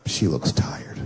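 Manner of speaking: breathy unvoiced aside; husband whispering to himself over sleeping wife; whispered thought. Hushed whisper.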